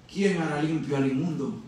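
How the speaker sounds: low, soft repeat of the question; hushed question. Acted. Preacher letting Job's question sink in, hushed question. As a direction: drop down and ask it gravely.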